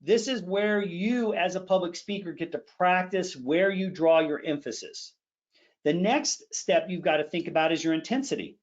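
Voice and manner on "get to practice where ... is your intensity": ordinary talk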